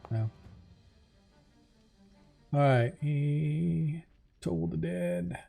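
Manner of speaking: ordinary delivery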